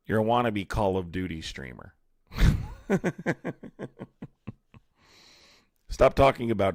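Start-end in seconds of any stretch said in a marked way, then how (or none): 2.3-4.3 high scornful burst of laughter; mocking laugh. Real, not acted. Streamer laughing at a wannabe Call of Duty streamer, scornful laugh. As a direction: laugh him off as a joke.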